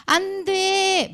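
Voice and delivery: high, drawn-out wail, imitating a child; loud, stretched-out clingy mock cry; mock crying protest. Child refusing to be parted from parents, mock crying. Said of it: "막 하면서 우는 렘넌트 있어요".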